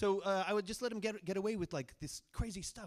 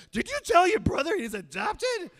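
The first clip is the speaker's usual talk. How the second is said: much higher, louder outraged mom, laughing through it; mock furious mom; 12 semitones higher, 12 dB louder than usual. Mom on the phone confronts her son, hammed-up furious yell. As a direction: play mom's disbelieving outrage.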